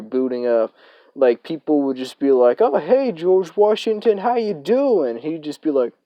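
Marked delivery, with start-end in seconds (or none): 2.6-5.2 much higher, slow, sing-song hello; bright high mock greeting; cheery greeting. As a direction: chirp it like an overly friendly classmate. People greeting the tripping guy.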